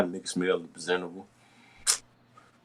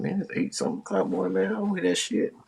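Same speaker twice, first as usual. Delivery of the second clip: groggy complaint; 13 semitones higher, 5 dB quieter than usual. Retold or imitated. Grumpy early morning reply.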